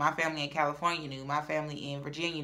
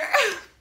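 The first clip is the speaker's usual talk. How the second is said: 1 laugh; 7 dB louder than usual. Real, not acted. Laughing at her own confession. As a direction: burst of high laughter.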